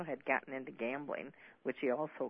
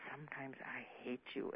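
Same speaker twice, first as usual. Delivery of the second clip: much softer, menacing hush; 9 dB quieter than usual. Retold or imitated. Husband pinning his wife to the wall, cold quiet menace. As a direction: say it quietly, dangerous.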